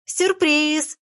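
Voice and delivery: выше, звонко и радостно; выкрикни «Сюрприз!» на радостях; радостный крик. Acted. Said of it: "хором крикнули Фенечка и Галка". (подруги хором кричат, звонко и выше)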